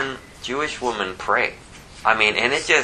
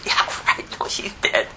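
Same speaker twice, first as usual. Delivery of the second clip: unvoiced, scoffing dismissal; breathy scornful retort; mocking scoff; 5 semitones higher, 4 dB quieter than usual. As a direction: sneer it under your breath. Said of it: "they're laughing at him because".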